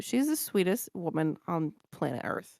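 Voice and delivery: usual speaking voice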